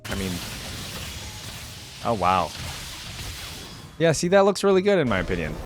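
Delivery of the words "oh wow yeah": usual speaking voice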